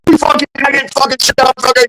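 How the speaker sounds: high-pitched imitation of yelling sergeants, held back in volume; mock angry yelling. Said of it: "they're yelling at me".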